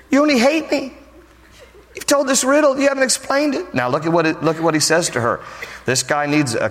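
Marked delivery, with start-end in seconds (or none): 0.1-3.7 whiny wife. Retold or imitated. Preacher voicing crying wife.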